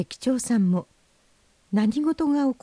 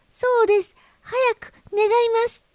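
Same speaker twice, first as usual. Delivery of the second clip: very high, squeaky fish voice; tiny creature pleading at top pitch; urgent begging; 4 dB louder than usual. Acted. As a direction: hurry them with a high, pressing plea. Goldfish urge the conductor.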